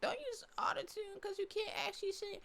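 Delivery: high, sneering mock whine; mocking critic voice. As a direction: parody the haters, squeaky. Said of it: "people be talking about".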